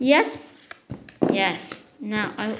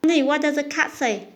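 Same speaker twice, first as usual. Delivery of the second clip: usual speaking voice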